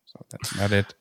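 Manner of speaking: halting low-key report; Elon Musk. Acted. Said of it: "Elon Musk and be like".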